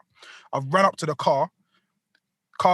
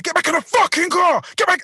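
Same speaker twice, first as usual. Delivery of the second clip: high-pitched angry shout; forceful, irate command; acted furious order; 12 semitones higher, 4 dB louder than usual. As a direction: re-enact yelling someone back into a car. Retold shouting at the old woman.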